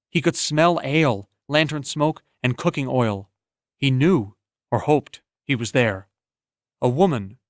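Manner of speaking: usual speaking voice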